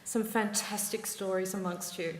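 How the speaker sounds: ordinary talk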